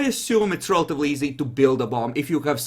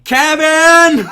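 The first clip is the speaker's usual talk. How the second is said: full-force high scream of a name; piercing, very loud yell; 15 dB louder than usual. Acted. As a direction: scream out the name at the top of your voice. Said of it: "she just goes screaming".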